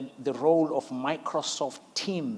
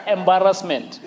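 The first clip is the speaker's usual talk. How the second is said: louder, said through laughter; laughing embarrassment; 3 semitones higher, 8 dB louder than usual. Real, not acted. Laughing about the car keys.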